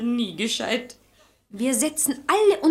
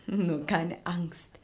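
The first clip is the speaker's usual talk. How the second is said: slow, soft reassurance; calm her fears gently; reassuring; 6 semitones lower, 9 dB quieter, much slower than usual. Acted. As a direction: soothe a frightened girl, slow and soft. Reassuring frightened girl.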